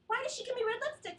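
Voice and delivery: ordinary talk, nothing marked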